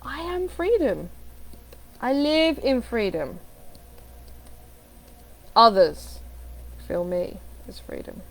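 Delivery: slow declarations with long pauses; measured affirmations. Shown as a demonstration. Coach modelling affirmations, slow and deliberate. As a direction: let each phrase land before the next.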